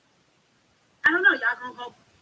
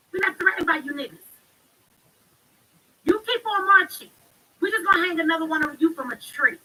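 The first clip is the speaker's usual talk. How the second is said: taunting lynchers; 7 semitones higher, 7 dB louder than usual. Retold or imitated. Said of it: "This is what they're telling you".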